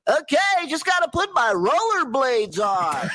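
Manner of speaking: high shouted goofy voice; Sour Shoes zany shout. Retold or imitated. Sour Shoes before sound check, high goofy shout. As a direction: yell it like a wild eccentric. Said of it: "He goes".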